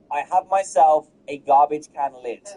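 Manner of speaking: ordinary talk, nothing marked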